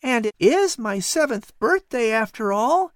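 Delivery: put-on high, eager boy voice; a curious boy talking to a cat; young boy Patrick. Boy Patrick wondering aloud to the cat, high and eager.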